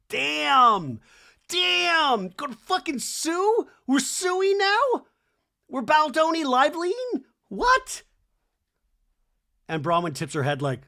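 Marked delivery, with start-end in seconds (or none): 0.1-8.0 high-pitched, hammed-up disbelief; mock shock. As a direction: exclaim incredulously, stretching it out high. Mock shock at lawsuit threat.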